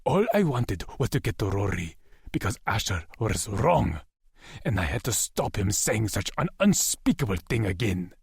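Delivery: ordinary delivery, nothing marked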